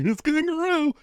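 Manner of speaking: squeaky-high, laughing mock exclamation; mock amazement. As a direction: shoot way up in pitch, acting amazed. Mock reaction to kangaroo on menu.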